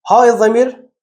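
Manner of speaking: usual speaking voice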